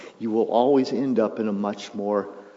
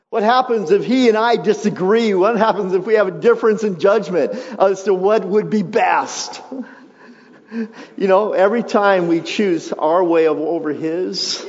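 louder, higher questions to the crowd; full-force preaching; 8 semitones higher, 6 dB louder than usual. Acted. Pastor voicing the congregation's doubt about trusting God, preaching at full force. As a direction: press the doubt hard.

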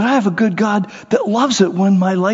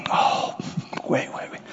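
hushed savoring; 9 dB quieter than usual. Retold or imitated. Imagined savoring dog.

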